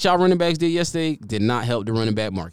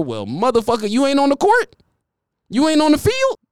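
much higher, rising disbelief on court and field; incredulous sarcasm; 13 semitones higher than usual. Acted. Answering critics of the blowout, high sarcastic disbelief. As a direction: squeal the questions in mock outrage.

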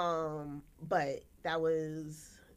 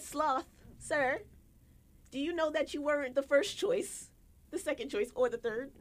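higher, louder sassy comeback; sassy retort; 8 semitones higher, 4 dB louder than usual. Retold or imitated. Telling her ex he was never first choice, sassy and raised. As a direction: put him in his place with attitude.

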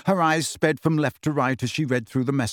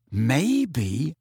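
drawn-out, tentative rise; slow. A narrator's reading. Ron floating a suspicion to friends, slowly. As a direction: stretch it out as a thought forms. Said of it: "said Ron slowly".

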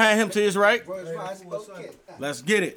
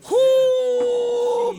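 whoo; 4 dB louder than usual. Real, not acted. Exclaims while recalling crying.

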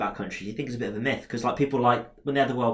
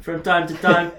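higher, louder put-on South African accent; 7 semitones higher, 5 dB louder than usual. Shown as a demonstration. Host defending his hobby voice to co-host, amateur South African accent. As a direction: keep up a rough South African impression. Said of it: "it's a voice I'd like to do".

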